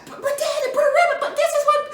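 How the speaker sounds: put-on high pleading girl's voice; a daughter begging her daddy, high and small; little girl voice. Daughter pleading with angry father, high girlish voice.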